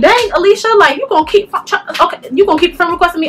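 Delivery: high, exasperated "Dang, Alicia" in disbelief. Retold or imitated. Retelling to viewers her reaction to Alicia's requests, high exasperated disbelief. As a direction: throw it up high like you can't believe her nerve. Annoyed she keeps re-requesting.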